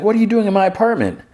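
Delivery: high-pitched, quick mock screech; mock scream. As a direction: imitate a startled woman screaming, without real volume. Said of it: "she, she screams".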